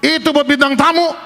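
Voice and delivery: high, loud booming-out challenge; suara cukup keras. Retold or imitated. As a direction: imitate a loud man telling someone off. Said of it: "suaranya cukup keras". (suara lantang menegur)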